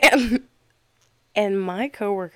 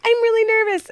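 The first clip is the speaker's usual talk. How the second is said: high, loud mock nerves; imitated nervous friend; 7 dB louder, faster than usual. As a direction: voice a friend's worry, pitched way up. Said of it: "A friend of ours was like".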